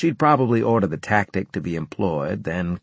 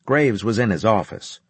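ordinary delivery, nothing marked

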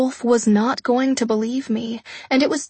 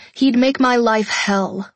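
plain reading voice

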